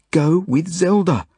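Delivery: high, drawn-out urgent plea; begging. A narrator's reading. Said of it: "I beg Barney".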